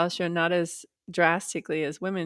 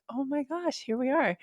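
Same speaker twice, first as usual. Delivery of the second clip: knowing surprise; 7 semitones higher than usual. Retold or imitated. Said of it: "And I was like".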